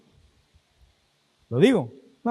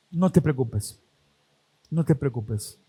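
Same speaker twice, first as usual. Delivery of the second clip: much softer, lower, gentle hush; tender reassurance; 8 semitones lower, 7 dB quieter than usual. Acted. God comforting the believer, soft and lower reassurance. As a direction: soothe a frightened child, softly.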